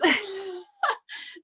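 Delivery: high teary laugh-sob; choked-up giggle; crying laugh. Real, not acted. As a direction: laugh as the tears come. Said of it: "I'm literally crying over here".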